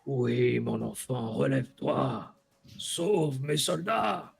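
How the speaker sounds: slow, solemn dragon voice. Acted. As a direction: speak slowly like an ancient dragon. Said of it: "Tu reconnais la voix du dragon".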